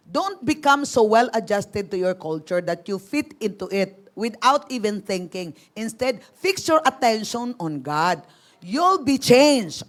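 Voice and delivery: slow, deliberate reading of the verse; slow scripture reading. Acted. Pastor reading the Message translation to the group, slowly. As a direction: let each phrase land for the listeners.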